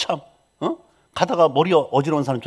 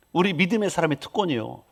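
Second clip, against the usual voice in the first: ordinary talk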